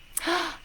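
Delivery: quick, high gasp of surprise; delighted gasp. Real, not acted. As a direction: suck in breath in happy surprise. Erica has just appeared.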